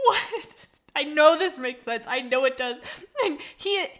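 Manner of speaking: high, loud laughing disbelief; real giggling fit while reading a comment; helpless laughter. Real, not acted. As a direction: laugh it out, half crying. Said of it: "Why am I having a mental breakdown".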